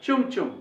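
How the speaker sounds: चुम चुम with unaspirated ch; English chum chum. Shown as a demonstration. Teacher imitates an Englishman reading it, unaspirated चुम चुम. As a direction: say चुम चुम with no puff on ch. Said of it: "इसको बोलेगा English man".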